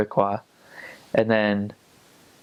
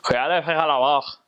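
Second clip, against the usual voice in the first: "Je suis allé fetcher la vache" rushed and mushed together, sounds swallowed; a thick Lac-Saint-Jean farmer; heavy joual accent; 4 semitones higher, faster than usual. Shown as a demonstration. Lac-Saint-Jean local fetching the cow, in heavy joual. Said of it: "Lac-Saint-Jean would say it more like".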